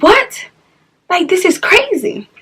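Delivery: high, startled "What?" then tailing off; stunned by the empty Instagram; mock shock at the missing photos; shocked disbelief. No pictures of the child.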